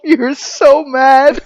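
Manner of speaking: words laughed out slowly; laughing, high and slow. Real, not acted. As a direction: giggle through the tease. Laughing while teasing partner.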